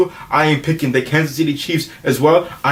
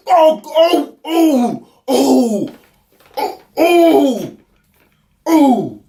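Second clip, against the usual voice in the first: comic anguished wails; 8 semitones higher, much slower than usual. Acted. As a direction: wail like it physically hurts. Co-host groans after the argument.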